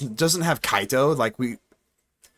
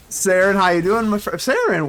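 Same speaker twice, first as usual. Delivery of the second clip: cheery greeting; 5 semitones higher, 3 dB louder than usual. Real, not acted. Greeting opponent on stream.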